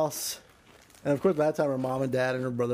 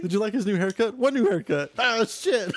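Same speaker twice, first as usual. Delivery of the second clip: raised playful character voices, laughing through; mock high voices; 7 semitones higher than usual. Storyteller acts out a dumb exchange in high voices. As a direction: toss the lines up high, half laughing.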